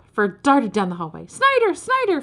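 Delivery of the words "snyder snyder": high-pitched screamed name; screaming. A narrator's reading. Ferd calling Snyder while fleeing down the hallway, screaming. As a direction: scream out for your friend, pitch way up. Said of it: "Ferd screamed".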